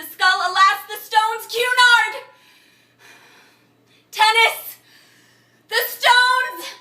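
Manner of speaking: very high, full-force screamed words; desperate shouted climax, last words drawn out; frenzied shouting. Acted. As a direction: shout each fragment as if losing all control. Acted monologue's shouted climax.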